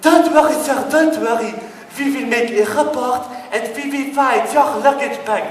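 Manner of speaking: sing-song reassurance from the desk; chirpy desk lady. Retold or imitated. Airline clerk soothing a passenger, high mock voice. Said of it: "desk lady reassuring".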